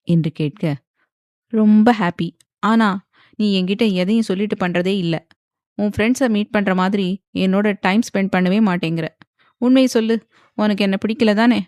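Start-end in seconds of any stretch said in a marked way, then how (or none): none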